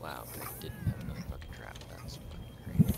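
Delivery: bitter whispered grumble; sarcastic under-breath whisper. Real, not acted. Frustrated gamer muttering to self.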